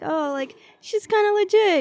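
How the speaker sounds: high, bright impressed quote; impressed follower voice. Retold or imitated. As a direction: squeal it like an impressed fan. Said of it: "gives you sort of that credibility that".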